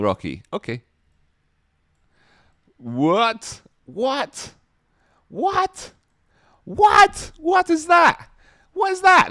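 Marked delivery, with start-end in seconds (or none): 2.8-7.3 each what higher and louder, ending in a shout; rising surprised what. Real, not acted. Streamer reacting to fan art, building to a shouted what. 7.4-9.3 each what higher and louder, ending in a shout; rising surprised what. Real, not acted. Streamer reacting to fan art, building to a shouted what.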